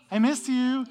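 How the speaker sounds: cute lovesick. Acted. Teacher acting out cute dating love, high lovesick gush. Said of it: "the cute kind of love".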